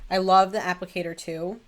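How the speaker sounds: ordinary talk, nothing marked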